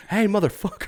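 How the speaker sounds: put-on young boys showing off; imitating kids cursing; kids swearing. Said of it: "and they're like".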